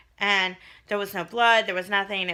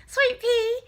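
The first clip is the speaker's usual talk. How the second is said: cooing; 4 dB louder than usual. Real, not acted. Cooing about baby.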